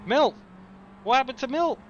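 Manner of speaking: sudden shocked high cry; surprised. Real, not acted. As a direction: caught off guard, voice jumps up. Driver suddenly dropped back.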